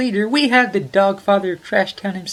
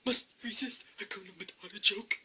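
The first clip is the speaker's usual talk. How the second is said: whispered to himself; breathy whispered repeat; 2 semitones higher, 12 dB quieter than usual. Shown as a demonstration.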